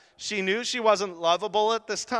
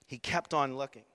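normal talking voice

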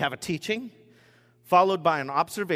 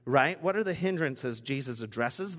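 normal talking voice

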